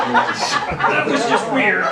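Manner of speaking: high breathy laughing, slow; awkward laugh. Acted. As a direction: laugh it off, weirded out. Awkward laugh after odd joke.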